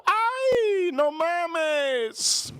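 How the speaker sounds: very high, drawn-out Mexican exclamation; cartel men's yell. Retold or imitated. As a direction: imitate masked men crying out in falsetto. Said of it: "They take off their masks, go".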